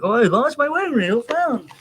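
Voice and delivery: hammed-up relieved father; imitating dad. Father finding his lost wedding ring, high mock voice. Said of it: "he's like".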